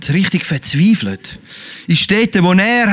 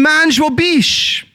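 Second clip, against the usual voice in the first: high, slowed call; God calling; 8 semitones higher, slower than usual. Acted. God calling out to mankind, high and slow. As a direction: lift it up high and stretch it out. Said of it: "Und Gott hat gerufen".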